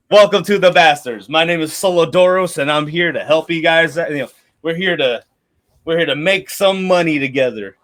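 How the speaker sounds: loud, hearty captain's greeting; mercenary leader welcome. Acted. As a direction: boom it out to the whole hall. Leader NPC introduces himself.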